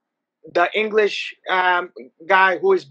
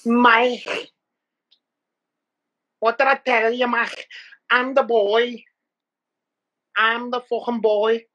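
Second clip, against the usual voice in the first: higher Scouse boast; Liverpool accent; 3 semitones higher than usual. Retold or imitated. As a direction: brag in Scouse. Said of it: "and he said".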